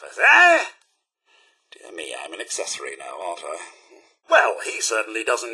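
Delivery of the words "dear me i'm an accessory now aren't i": low, soft, quick mumble to himself; worried aside. Acted. Butler realising he is now an accessory, worried aside. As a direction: fret under your breath.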